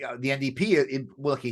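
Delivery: normal talking voice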